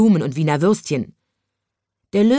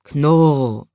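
spoken growl, louder; lion growl; 2 semitones lower, 4 dB louder than usual. A narrator's reading. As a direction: growl the word like a sulking lion. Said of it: "sagte der Löwe".

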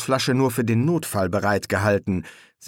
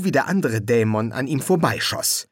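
ordinary delivery, nothing marked

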